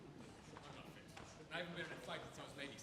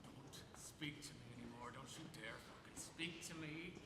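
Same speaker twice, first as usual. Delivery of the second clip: low, slow and softer, menacing; cold threat; 6 semitones lower, 5 dB quieter than usual. Retold or imitated. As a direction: hold back the rage, slow and low. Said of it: "said to her".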